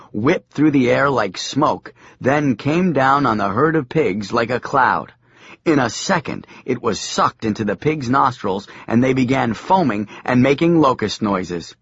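ordinary delivery, nothing marked

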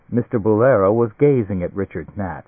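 plain reading voice

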